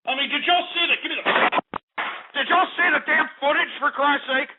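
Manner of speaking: high-pitched, indignant outburst; pissed off. Real, not acted. Host asking listeners about looting footage, pissed off. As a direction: demand an answer in angry disbelief. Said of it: "I'm just getting pissed off".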